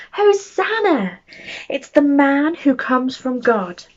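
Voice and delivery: high, slow, drawn-out cheer of the crowd. Acted. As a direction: call it out like a joyful crowd. Crowd shouting in story.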